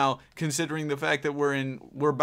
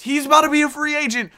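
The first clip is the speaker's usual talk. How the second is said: surprised alarm; 12 semitones higher, 7 dB louder, faster than usual. Real, not acted. Surprise discovery of T-Mac.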